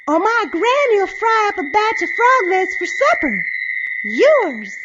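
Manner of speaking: put-on high, loud, feisty girl; loud spirited threat. A narrator's reading. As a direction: holler the threat at the bully. Girl threatens the bully in story.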